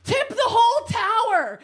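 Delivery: high loud command; friend's order. Retold or imitated. Friend ordering all towels down, high loud command. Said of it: "she's like".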